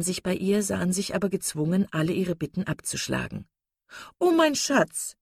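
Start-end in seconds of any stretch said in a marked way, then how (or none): 4.2-5.1 high-pitched wifely cry; calling out. Acted. Wife calling to her husband as he enters, effusive. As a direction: call sweetly to your husband in a raised pitch. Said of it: "rief Misses Palmer ihrem Mann zu".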